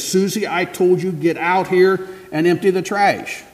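high, loud, slow angry command; angry mother. Acted. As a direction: lay down the law, fed up. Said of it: "in anger says".

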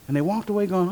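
ordinary talk

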